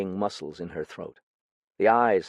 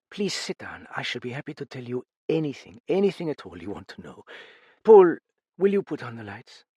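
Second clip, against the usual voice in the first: a lightly voiced tired hostess, a touch higher; weary older woman; 4 semitones higher, 4 dB louder than usual. A narrator's reading. Lightly voiced widow greeting her visitors. Madame Duchesne speaks.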